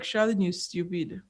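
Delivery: soft, half-laughing scold to herself; a hushed mock scold at her younger self; self-scolding mutter. Looking back at her own mistake, a soft mock scold. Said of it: "laugh and be like".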